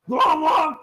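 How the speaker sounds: high, loud babbling gibberish; lizard gibberish. Acted. Lizard in the water babbles back loudly. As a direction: babble nonsense like a creature.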